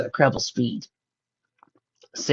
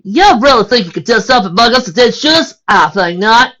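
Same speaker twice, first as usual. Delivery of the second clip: defiant; 10 semitones higher, 10 dB louder than usual. Acted. Defiant against the mugger.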